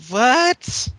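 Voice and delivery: high confused what; puzzled, rising what; baffled what. Real, not acted. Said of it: "I don't even know what that means".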